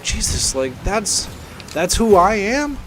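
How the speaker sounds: shocked at himself. Retold or imitated. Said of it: "And I'm like".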